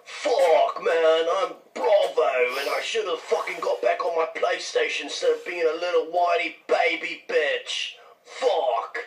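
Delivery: exaggerated whiny impression of a British friend's accent; mock British accent. American mocking a British gamer in voicemails, mock British accent. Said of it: "I think I have your accent down perfectly".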